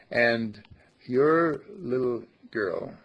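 usual speaking voice

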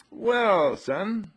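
high, loud drawn-out greeting; acted mom, hailing her boy; mother addressing son. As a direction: pitch it up like an indulgent mother.

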